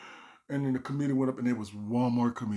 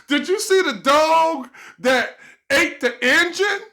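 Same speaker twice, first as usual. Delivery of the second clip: high loud slow stage voice; joke delivery; 12 dB louder, slower than usual. Retold or imitated. Comic telling a bombing joke, loud slow. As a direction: sell the punchline big.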